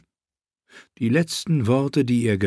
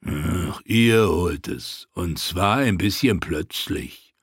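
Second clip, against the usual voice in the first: lower, slow, mocking echo; slow sneering mockery; 4 semitones lower, slower than usual. A narrator's reading. Narbe mocks Tyrion's own phrase, low and slow. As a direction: throw his words back, slowly.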